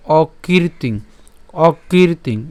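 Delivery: অকীর্তিং with a long, drawn-out "kii"; slow model pronunciation of অকীর্তিং, "kiir" lengthened; long i demonstration. Shown as a demonstration. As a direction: hold the ī in অকীর্তিং. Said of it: "ক দীর্ঘিকারের সাথে যদি উচ্চারণ করি".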